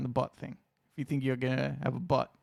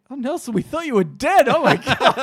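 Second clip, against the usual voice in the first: shocked coworker; 9 semitones higher, 10 dB louder than usual. Retold or imitated.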